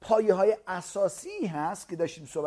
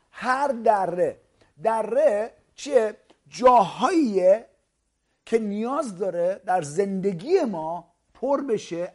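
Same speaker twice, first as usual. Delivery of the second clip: each word drawn out and louder; slow emphatic preaching; 2 semitones higher, 5 dB louder, much slower than usual. Acted. Preacher explaining the valley to listeners, slow and emphatic. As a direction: lean on every word for the crowd.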